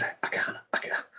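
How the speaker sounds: breathy, halting whisper; faint, broken whispered attempt; struggling. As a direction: re-enact being pinned and short of air. Retelling being unable to move.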